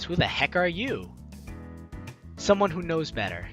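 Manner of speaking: usual speaking voice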